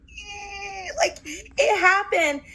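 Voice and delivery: high, slow choked cry; tearful joy. Retold or imitated. Walking with Dee and Julie after the blindside, a high choked cry. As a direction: squeeze out a tearful happy cry. Allies silently grabbing hands.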